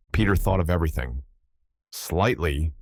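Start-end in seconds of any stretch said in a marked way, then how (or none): none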